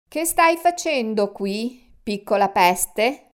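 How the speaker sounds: slow, loud booming man's voice; angry guard thundering at an intruder. Acted. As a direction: boom it down at the boy. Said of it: "tuonò l'uomo".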